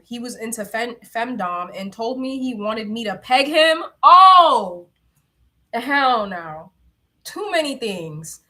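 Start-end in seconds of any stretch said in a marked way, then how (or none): 4.0-6.7 loud shocked hell no; loud outburst. Real, not acted. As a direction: blurt it out, appalled. Reacting to pegging request.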